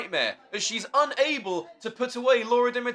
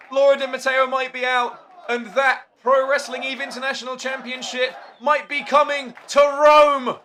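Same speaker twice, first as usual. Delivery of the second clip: ordinary talk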